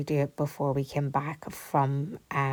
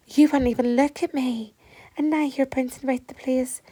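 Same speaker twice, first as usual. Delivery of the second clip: higher and louder, playfully offended; mock indignation; 9 semitones higher, 6 dB louder than usual. Owner complaining to the dog that ignored her, in mock indignation. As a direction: tell the dog off, half joking. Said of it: "And I was like".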